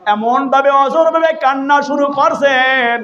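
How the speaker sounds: high sing-song sermon chant; melodic preaching. Retold or imitated. Preacher retelling Omar questioning a mother, melodic preaching. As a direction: chant the retold dialogue high, with drawn-out line ends.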